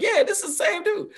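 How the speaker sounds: high, laughing mock viewer. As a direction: blurt it while cracking up. Laughing imagined viewer line.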